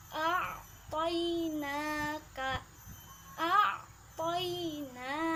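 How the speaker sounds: a'tainaka slowly, the ain sounded from the throat; slow a'tainaka with a distinct ain; distinct alif and ain. Shown as a demonstration. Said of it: "alif dan ain harus berbeda".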